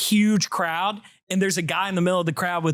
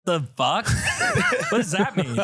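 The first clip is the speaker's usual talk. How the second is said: baffled laugh. Retold or imitated.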